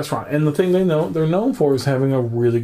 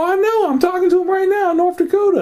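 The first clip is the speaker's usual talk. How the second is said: excited. Retold or imitated. Excited phone call to dad.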